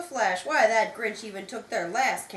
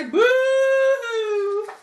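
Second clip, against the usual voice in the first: high, loud hammed-up boo-hoo; mocking sob sound; mock crying boo-hoo; 11 semitones higher, 11 dB louder than usual. A narrator's reading. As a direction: wail it like a fake crybaby. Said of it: "will all cry".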